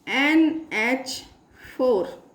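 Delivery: letters stretched far apart; slow dictation. Real, not acted. Teacher writing out a formula for class, slow dictation. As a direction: spell it slowly as you write.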